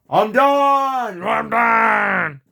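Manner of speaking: drawn-out, wailing shout; shouting in panic. Acted. Frightened explorer quitting the hunt, shouting in panic.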